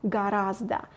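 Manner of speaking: normal talking voice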